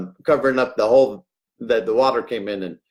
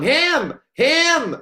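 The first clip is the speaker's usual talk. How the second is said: high repeated bird cry; parrot squawk; 13 semitones higher, much slower than usual. Retold or imitated. Panicking parrot points out the speaker, high squawk. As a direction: peck out each word like a frantic parrot. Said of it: "he was going".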